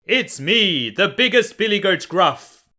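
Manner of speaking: a big goat voice as big as the troll's. A narrator's reading. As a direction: answer boldly, unafraid. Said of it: "in a voice as big as the troll's own".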